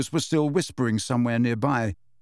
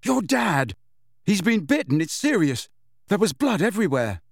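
frightened and breathless, a bit higher; panicked; 6 semitones higher than usual. A narrator's reading. Neville telling Ron his dad was attacked, frightened. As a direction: blurt the news in alarm.

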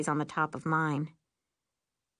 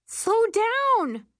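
high, loud panicked shout; scream; 6 dB louder than usual. A narrator's reading. Passenger on spinning broom, screaming. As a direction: yell high for her to slow. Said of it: "I scream".

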